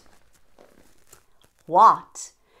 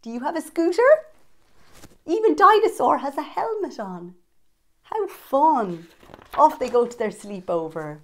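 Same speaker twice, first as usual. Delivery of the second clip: usual speaking voice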